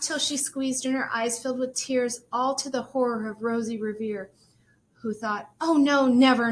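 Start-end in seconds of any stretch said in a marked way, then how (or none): 5.6-6.5 loud, stricken "never"; dismayed outcry. Acted. Rosie after the crash, crying out loud in dismay. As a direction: cry out in dismay.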